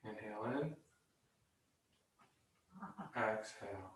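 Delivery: very soft, low breath cues; guided breathing meditation. Shown as a demonstration. Yoga teacher leading three silent breaths, very soft and low. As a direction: sink into a hushed, low voice, one cue per breath.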